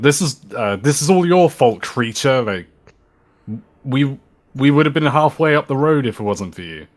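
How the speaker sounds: angry shout. Acted. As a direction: shout the blame at the creature. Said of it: "Moonin would shout".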